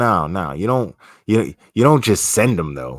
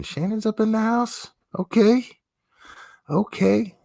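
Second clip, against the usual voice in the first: squeaky-high falsetto welcome; playful high-pitched greeting. Pretend. Playful greeting of arriving chat member.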